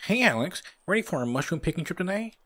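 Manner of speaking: high-pitched, eager buddy voice; high put-on game character voice; Bob. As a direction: pitch it way up for a chipper sidekick.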